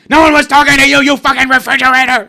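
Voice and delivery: very loud, high furious yell; angry scream. Acted. Cranky patient rebuffs a nice AI, furious high scream. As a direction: scream down the helpful machine. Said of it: "And then I kinda just piss on it".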